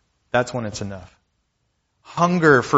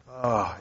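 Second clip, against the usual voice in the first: weary exhale of frustration; exasperated sigh; 6 dB quieter than usual. Acted. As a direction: sigh as if at your wits' end.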